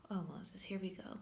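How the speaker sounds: whispered aside. Real, not acted. Teacher whispering a wry aside to her viewers. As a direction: breathe it like a secret joke. Aside while reading Moses' excuse.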